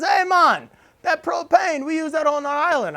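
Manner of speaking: loud, high Jamaican accent impression; badly imitated Jamaican neighbor calling out; horrible Jamaican accent. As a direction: do a hammed-up bad Jamaican accent. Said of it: "I have a horrible Jamaican accent".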